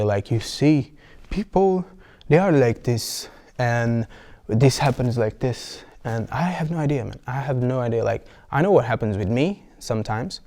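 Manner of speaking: usual speaking voice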